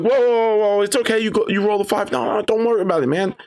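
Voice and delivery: high, soothing reassurance to a player; friendly high reassuring tone. Acted. GM reassuring player, acted quote.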